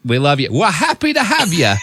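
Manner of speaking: high, put-on New Zealand accent; playful New Zealand accent; mock Kiwi accent. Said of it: "I can't do that".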